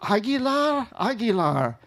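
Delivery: high startled "Aguilar?" then unsure "Aguilar."; confused echo of a strange word; puzzled mishearing. Retold or imitated.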